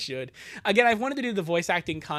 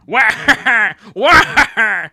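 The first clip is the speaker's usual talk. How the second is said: loud cartoon yelp; high yelp; 3 semitones higher, 14 dB louder than usual. Comic character yelps.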